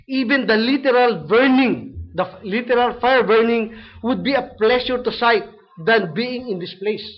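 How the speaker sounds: emphatic quoted warning. Retold or imitated. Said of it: "sabi niya".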